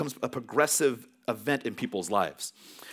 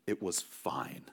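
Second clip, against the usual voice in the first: ordinary talk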